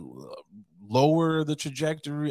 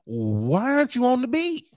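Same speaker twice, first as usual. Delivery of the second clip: higher, louder mock indignation; mock interrogation; 8 semitones higher, 5 dB louder than usual. Podcaster pretending to question a police officer, mock indignant. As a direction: playfully demand an answer from the officer.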